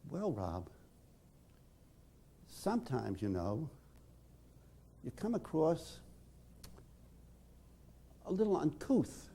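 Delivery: soft, hesitant and careful; timid. Retold or imitated. Timid Vicky telling Rob he is uncouth, softly. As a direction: tiptoe around the insult. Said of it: "a very timid soul".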